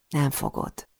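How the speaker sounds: soft, offhand contradiction; casual dismissal. A narrator's reading. Intruder dismisses her threat of police, nonchalantly. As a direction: brush it off casually, without raising the voice. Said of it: "Rázza meg a fejét lezseren".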